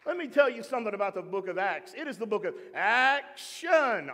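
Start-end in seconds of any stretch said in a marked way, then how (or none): 1.9-4.1 ACTION drawn out slowly with showman punch; children's church hype. Shown as a demonstration. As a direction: sell "action" big, stretching both syllables. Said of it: "That's back in my children's church days".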